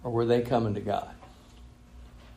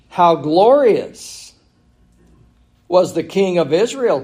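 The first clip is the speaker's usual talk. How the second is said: sarcastic; 5 semitones higher, 5 dB louder than usual. Acted. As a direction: read it as Michal's scornful sarcasm. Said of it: "Can you hear the sarcasm".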